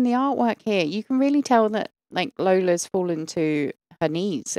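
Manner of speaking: normal talking voice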